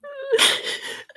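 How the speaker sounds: long, high-pitched sob; real crying wail. Real, not acted. Sobs mid-account.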